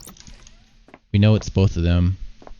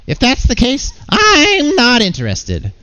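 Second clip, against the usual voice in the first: very high, drawn-out character voice; indignant cartoon refusal; 4 dB louder, slower than usual. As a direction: huff it out squeakily, stretching the words. Same character refuses the offer.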